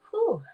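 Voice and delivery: high, loud whew; sharp falling sigh of "whew". Real, not acted. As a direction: let out a high whew before going on. Exhales before a hard topic.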